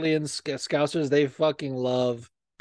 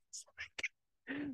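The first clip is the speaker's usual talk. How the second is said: whisper; 19 dB quieter than usual. Real, not acted. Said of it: "sarcastic aside".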